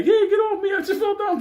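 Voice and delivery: hammed-up high-pitched cry of protest; squeaky falsetto protest. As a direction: squeal it like the shoved lady. Mimicking lady pushed in lobby.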